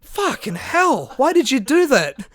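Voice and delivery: high, loud exasperated protest; retold yelling. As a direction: scold the one who woke you, re-enacted. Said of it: "when I yelled at him for sleepwalking".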